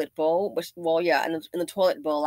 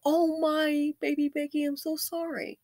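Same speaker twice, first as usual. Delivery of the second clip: higher, hammed-up pitying voice; mock sympathy; 7 semitones higher than usual. Owner pitying evicted baby Becky, high mock sympathy. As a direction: coo a fake apology to a child. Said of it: "you know, go".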